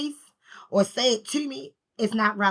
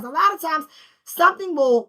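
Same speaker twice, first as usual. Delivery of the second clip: usual speaking voice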